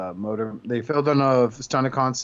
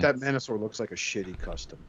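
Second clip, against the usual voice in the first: understated letdown, a bit softer; mildly mimicked disappointment; imitated disappointment; 3 semitones lower, 7 dB quieter, faster than usual. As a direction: quote him quietly let down. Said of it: "he just sounds so disappointed".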